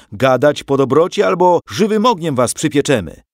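loud, high-pitched menace; roaring threat. A narrator's reading. Soldier threatens to roast the family, roaring. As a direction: threaten at full voice. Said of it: "ryknął jeden".

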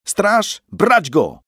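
high, loud alarm call; calling the guard. A narrator's reading. Robbed man yells for the guards, high and loud. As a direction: shout for the watch.

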